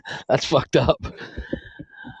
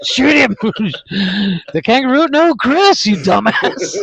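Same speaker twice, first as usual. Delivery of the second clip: playing both sides of a frantic argument; mock frantic yelling; 8 semitones higher than usual. Acted frantic shooting exchange.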